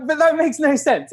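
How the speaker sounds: high-pitched mock protest; imitated bafflement. Others hearing the runner's plan, mock baffled and high. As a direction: object in an amused high voice.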